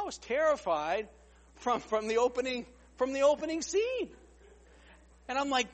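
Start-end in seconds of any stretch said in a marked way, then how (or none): none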